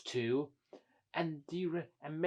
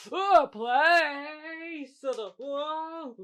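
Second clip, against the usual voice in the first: high, drawn-out startled whoa; startled cry; 11 semitones higher, 7 dB louder, much slower than usual. Pretend. Engines suddenly running away downhill, high startled cry. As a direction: wail it high as you roll off.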